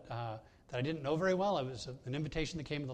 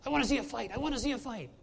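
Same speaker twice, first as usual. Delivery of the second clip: eager, high, loud cry for a fight; excited; 9 semitones higher, 6 dB louder, faster than usual. Retold or imitated. Woman in the hockey box begging excitedly for a fight. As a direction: shake a fist and beg for a brawl, high and loud. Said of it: "turned to me and said very excitedly".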